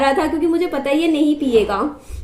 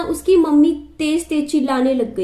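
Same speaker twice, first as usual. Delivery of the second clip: normal talking voice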